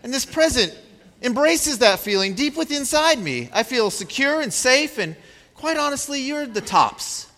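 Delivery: put-on high, gushing child voice; child gushing thanks to mother and father, high and sweet; imagined grateful child. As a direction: play the adoring child thanking parents. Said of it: "to turn to us and say".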